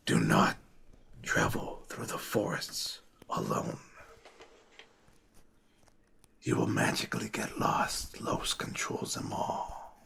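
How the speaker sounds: grip them and whisper the warning; whispering old lady. Acted. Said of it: "old lady hand grasps".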